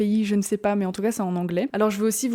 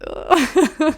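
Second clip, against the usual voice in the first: high burst of laughter; amused laugh; 7 semitones higher, 5 dB louder than usual. Real, not acted. Laughing at her own English accent, amused laugh. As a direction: crack up at your own attempt.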